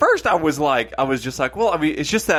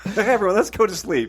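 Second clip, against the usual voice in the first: lightly put-on higher voice, half laughing; mock cheerful suggestion; 7 semitones higher than usual. Friend jokingly proposes bedtime after the scare, mock cheerful. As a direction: jokingly pitch it up like a carefree announcement.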